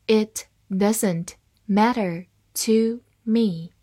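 "It doesn't matter to me", slowly, word by word; slow read-along. Shown as a demonstration. Teacher reading the example for learners to follow, slow and separated. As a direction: leave a gap after every word for learners. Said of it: "好，慢慢来读".

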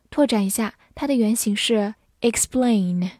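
usual speaking voice

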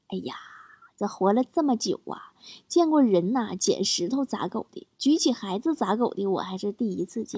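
soft, marvelling auntie murmur; disbelieving passer-by auntie. Retold or imitated. Passing auntie watching a father hold up his child, soft disbelief. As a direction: imitate an old hand's hushed disbelief. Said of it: "路过的大妈看了，连连摇头说".

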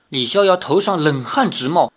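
ordinary delivery

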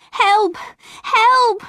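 very high urgent cry; boy running to the village crying for help; calling for help. A narrator's reading. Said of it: "I called to the villagers".

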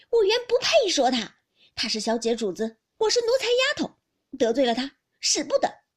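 loud, high-pitched indignant outburst; angry retort. A narrator's reading. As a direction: throw his excuses back at him, loudly. 湘云甩手与宝玉争吵. (气冲冲地大声顶撞)

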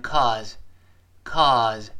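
cause, cause said slowly with a faded final sound; reduced ending. Shown as a demonstration. Teacher showing the weak z in cause. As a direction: let the end of cause almost vanish. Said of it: "我们读快的时候几乎听不清了".

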